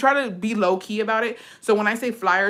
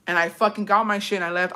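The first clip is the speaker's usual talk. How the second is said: ordinary delivery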